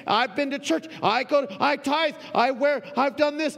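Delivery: loud, high, indignant listing; older son defending his record. Retold or imitated. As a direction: tick off every good deed. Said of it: "the older son starts listing all the good things".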